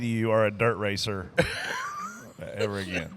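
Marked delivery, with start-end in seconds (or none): 1.3-2.1 high-pitched burst of laughter; high squealing laugh. Real, not acted. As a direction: let out a shrieking laugh. Group cracking up.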